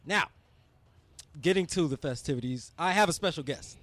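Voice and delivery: usual speaking voice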